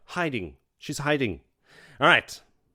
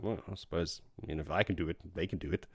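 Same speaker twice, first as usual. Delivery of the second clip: much softer, lower grudging admission; 4 semitones lower, 10 dB quieter, faster than usual. Retold or imitated. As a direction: concede it quietly, half to himself. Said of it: "And he's like".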